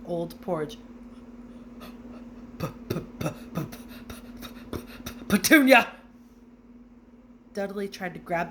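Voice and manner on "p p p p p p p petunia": breathless p-p-p that bursts into a loud cry of the name; so shaken the name won't come out; shocked stammer. A narrator's reading.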